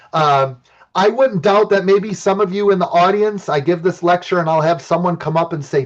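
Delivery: ordinary talk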